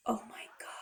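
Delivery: hushed, voiceless gasp of disbelief; stunned whisper. Retold or imitated. Woman seeing her wrecked car, stunned whisper. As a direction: breathe it out as a retold whisper of shock.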